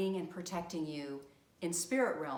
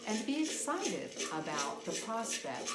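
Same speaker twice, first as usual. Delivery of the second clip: guided meditation. Acted. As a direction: guide them into relaxation, phrase by unhurried phrase. Leads guided visualization over drumming.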